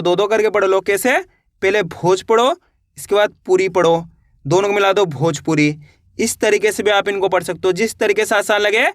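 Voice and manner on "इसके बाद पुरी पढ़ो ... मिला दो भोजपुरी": ordinary talk, nothing marked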